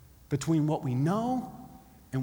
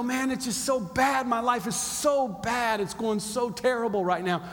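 raised, pleading voice; desperate plea; 5 semitones higher than usual. Acted. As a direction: plead with heaven, pitch pushed up. Acted desperate plea to God.

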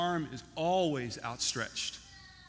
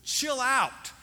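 exasperated mock yell; 9 semitones higher, much slower than usual. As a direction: act out a fed-up owner's outburst. Owner shuts parrot in freezer.

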